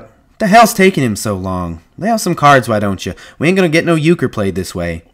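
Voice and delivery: loud, high, exasperated burst; impatient outburst. A narrator's reading. Whit snapping the silence over the card table, impatient. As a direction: break out with pent-up impatience. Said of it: "Whit broke out".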